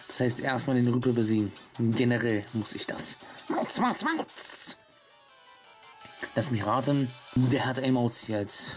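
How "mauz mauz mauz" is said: very high catlike meows; cat meowing. Pretend. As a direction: meow it like a cat. Imitating cat statue.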